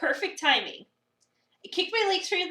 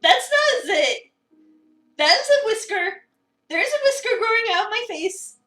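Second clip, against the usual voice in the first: higher and louder, alarmed; shocked realisation; 7 semitones higher, 8 dB louder than usual. Acted. Avery discovers a whisker in the mirror, alarmed. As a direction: gasp it out in rising horror.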